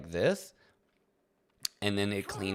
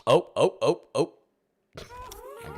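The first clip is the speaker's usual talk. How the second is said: excited cries; 9 semitones higher, 5 dB louder, slower than usual. Real, not acted. Struggling to park the mount.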